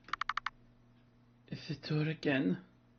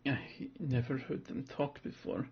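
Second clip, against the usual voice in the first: shaken murmur; 7 dB quieter than usual. Acted. Shaken child calms self quietly.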